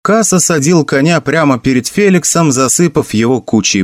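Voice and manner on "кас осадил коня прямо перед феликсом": usual speaking voice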